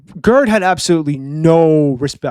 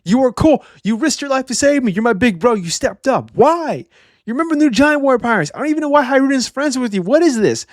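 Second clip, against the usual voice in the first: much higher, whining complaint; whiny little brother; 8 semitones higher than usual. Retold or imitated. Bjorn whining at his cringe big brother, much higher. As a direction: plead like a disappointed kid brother. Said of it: "He's like".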